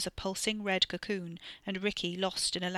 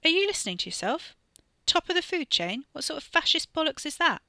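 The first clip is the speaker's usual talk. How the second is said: incredulous; 9 semitones higher, 5 dB louder than usual. Acted. Wes scornful argument.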